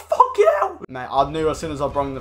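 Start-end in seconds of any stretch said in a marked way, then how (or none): 0.0-0.8 very high, loud curse; furious shout. Real, not acted. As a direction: explode at the goal against you. Conceding a goal.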